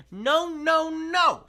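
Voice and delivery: put-on very high, loud baby shout. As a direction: scream no at the villain. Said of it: "Sunny shouted".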